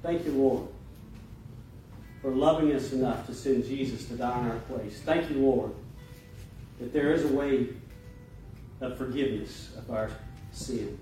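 earnest, measured spoken prayer. Acted. Pastor thanking God and asking forgiveness, earnest prayer. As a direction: pray aloud in short phrases, pausing between them.

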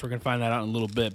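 ordinary talk

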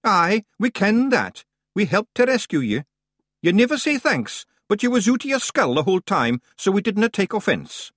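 high, loud broad Scottish pixie voice; a cocky wee Scots fighter; tiny Scots warrior. Acted. Rob Anybody answering the boy, high broad Scots.

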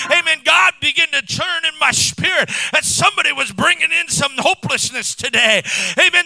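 loud, high fervent preaching; preaching at full force. Acted. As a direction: preach it with fire. Preaching climax.

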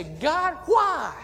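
rising to a high shouted why; cry of pain. Shown as a demonstration. Preacher shows the congregation crying out, shouted why. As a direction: call out to God in pain, shouted. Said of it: "That's a cry of pain".

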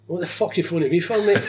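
mock-indignant laughing comeback. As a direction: blurt it out through a laugh. Retelling rude customer, laughing.

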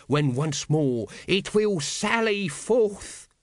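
high, slow, portentous recital; intoned. Acted. Nobby describes the sleeping dragon, intoning. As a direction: chant it like a grand old legend. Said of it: "intoned Nobby".

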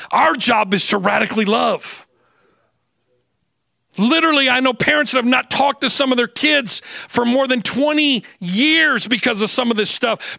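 loud, high shouted sermon; impassioned preaching. Acted. Preacher urging the church to love, shouting. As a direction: drive the point home from the pulpit.

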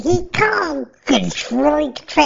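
high squeaky alien guy; little alien voice. Retold or imitated. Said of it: "I'm gonna use his little alien voice".

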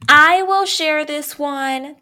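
high, loud, slow mock announcement; playfully theatrical. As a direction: accept with drawn-out, hammed-up flourish. Playful answer to co-host's cue.

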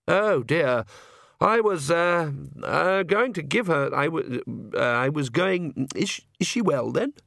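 a shy priest who can't finish a sentence; nervous young priest, flustered. A narrator's reading.

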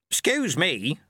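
gossipy talking bird; chatty raven voice. Acted.

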